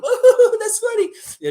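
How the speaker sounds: very high, laughing through the words; giggling. Retold or imitated. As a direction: squeal it out like being tickled. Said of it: "he's, like, laughing".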